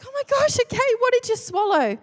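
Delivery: high, anxious question; alarmed. Retold or imitated. Wife questions choking husband, high alarmed voice. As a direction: ask in rising alarm.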